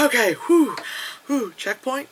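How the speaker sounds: high puffs of acted relief; relieved gamer. Player reaching the checkpoint, mock relieved. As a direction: puff it out as if just making it.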